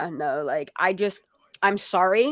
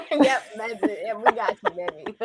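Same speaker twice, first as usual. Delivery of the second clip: laughing, teasing reassurance. Real, not acted. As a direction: giggle through the words. Laughing reply to Greg.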